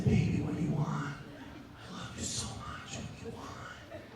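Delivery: soft, breathy bedroom hush; whispered question. Acted. Bert asking his girlfriend what she wants, whispered. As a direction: whisper it slowly to a lover.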